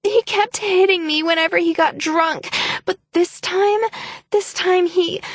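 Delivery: high, tearful, breaking sobbing plea; frantic tears. Acted. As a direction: sob out the story as if it hurt. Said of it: "burst into frantic tears".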